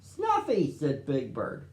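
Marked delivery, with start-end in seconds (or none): 0.0-0.7 very high delighted cry; joyful call. A narrator's reading. Big Bird greeting Snuffy, high joyful call. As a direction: call out to a friend.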